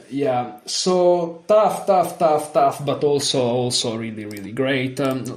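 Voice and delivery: usual speaking voice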